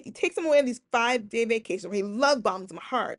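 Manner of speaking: ordinary talk, nothing marked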